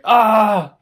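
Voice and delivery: loud, high frustrated scream; retold scream. Reenacting screaming in the laundry room, loud scream. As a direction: let out a short scream of rage. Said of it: "I screamed".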